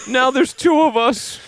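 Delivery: high, slow comic wail; mock drowning cry. Second swimmer in trouble to lifeguards, mock wail. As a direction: call out in pretend distress.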